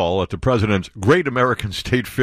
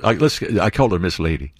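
usual speaking voice

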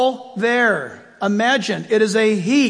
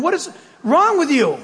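higher, laughing mock exasperation at the GPS; exasperated scolding; 6 semitones higher than usual. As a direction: scold the sat-nav, half laughing.

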